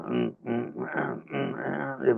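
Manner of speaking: soft rhythmic "mm" grunts; hushed little singalong grunts, imitated; imitated grunting along. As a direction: mimic a player grunting along to the music. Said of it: "And it sounded like".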